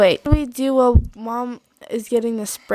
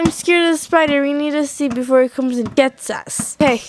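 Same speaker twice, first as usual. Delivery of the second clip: usual speaking voice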